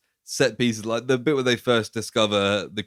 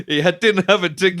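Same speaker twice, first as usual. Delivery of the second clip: higher, louder mock innocent excuse; deadpan excuse; 8 semitones higher, 4 dB louder than usual. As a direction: play the dad fobbing off the guard. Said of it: "He's like".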